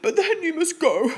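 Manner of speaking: sniffling. A narrator's reading. Bear sniffles to the mouse, mild mock. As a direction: sniffle out the condition. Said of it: "he sniffled".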